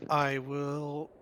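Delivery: ordinary delivery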